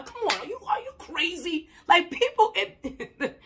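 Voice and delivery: usual speaking voice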